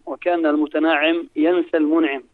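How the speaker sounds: ordinary talk, nothing marked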